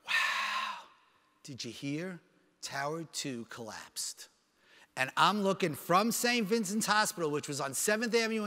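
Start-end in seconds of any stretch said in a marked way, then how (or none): 0.1-4.3 low, soft voice of a shocked bystander; stunned, hushed. Retold or imitated. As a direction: break awful news slowly, almost under your breath. Retold stranger breaking terrible news.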